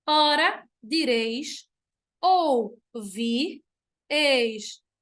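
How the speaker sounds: "ouvir estrelas" broken into separate syllables. Shown as a demonstration. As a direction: dictate "Ora, direis ouvir estrelas" piece by piece with pauses. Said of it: "fiz basicamente uma separação gramatical".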